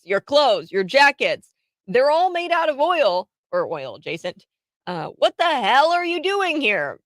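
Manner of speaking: high-pitched retold rant at protesters; irate bystander impression. Radio host retelling a Brit's rant at protesters, mock irate. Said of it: "what he says here is pretty hilarious. He goes".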